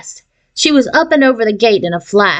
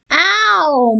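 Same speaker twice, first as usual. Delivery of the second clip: high, whiny little sister; whining; 8 semitones higher than usual. A narrator's reading. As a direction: whine it. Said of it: "Melissa whined".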